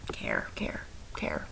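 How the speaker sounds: breathy playful whisper repeating care. Pretend. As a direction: whisper it like a secret to the class. Teacher playfully whispers to class.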